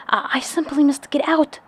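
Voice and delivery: loud breathless high gasp; panic, fighting for breath. A narrator's reading. Alice gasping at the doorknob to escape. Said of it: "she gasped".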